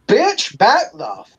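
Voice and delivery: high-pitched, stretched-out comeback; mock-outraged rebuff. Brother looks down at the girl, high indignant rebuff. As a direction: play an indignant brother warning someone off.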